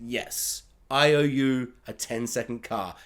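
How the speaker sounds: low, softly spoken movie hero; mock-solemn low quote, lightly acted; Paul Walker. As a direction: drop low and quiet, like a film line. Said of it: "Paul Walker's like".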